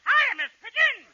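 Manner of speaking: put-on high, loud little-boy greeting; put-on child's voice; high kid voice. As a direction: pitch it up like a small boy. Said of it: "Oh, high voice".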